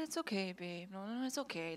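soft, hushed reassurance; mock tender comforting murmur; soothing boyfriend. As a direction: coo it to calm a crying partner. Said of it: "And they're like".

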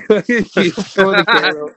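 ordinary talk

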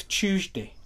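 "Tuesday" begun with a ch sound, like "Chewsday"; British pronunciation of Tuesday. Retold or imitated. Host mimicking British speakers, "Tuesday" as "Chewsday". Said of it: "I don't fucking know why, but it's always".

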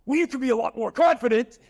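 high, loud imitation of a disappointed mentor; loud mock rebuke from the mentor; imitating Tony. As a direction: mimic a big coach who is unimpressed. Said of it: "He goes".

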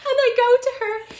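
high, fast words through real laughter; laughing. Real, not acted. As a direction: squeeze the words out mid-laugh. Still laughing at her story.

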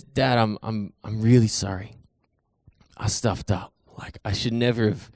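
acted remorse. Son says sorry to his dad, acted remorse. Son's apology to father, retold.

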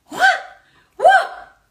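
high, delighted what; a startled, laughing what; mock-astonished, half laughing; amazed. Real, not acted. Reacting to the answered prayer.

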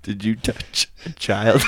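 slow, laughing mock TV interrogator; laughing gotcha question; mock Chris Hansen. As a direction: play the sting host through a laugh.

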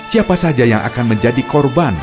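dramatic radio narrator; story narration. A narrator's reading. Narrator closing the episode.

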